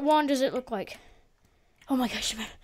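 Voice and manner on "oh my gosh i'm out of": breathless and winded; gasping for air mid-sentence; out of breath. Real, not acted. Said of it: "I'm so out of breath".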